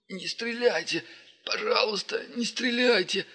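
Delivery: high, desperate begging; pleading. Acted. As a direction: beg for your life. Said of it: "умоляюще произнёс он".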